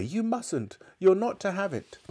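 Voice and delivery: put-on high, urgent child's warning; crying out. A narrator's reading. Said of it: "George cried".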